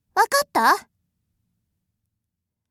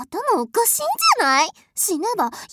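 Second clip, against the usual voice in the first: normal talking voice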